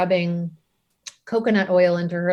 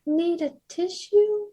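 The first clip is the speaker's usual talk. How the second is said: put-on small toddler voice, much higher and slow; little child voice; 9 semitones higher, slower than usual. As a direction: offer it like a two-year-old. Toddler offers tissue, voiced again.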